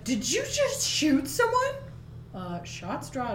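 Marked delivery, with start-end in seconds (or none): 0.0-1.7 much higher, shocked squeak; high squeaky voice. Retold or imitated. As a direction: squeak it out in disbelief.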